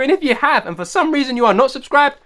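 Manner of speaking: normal talking voice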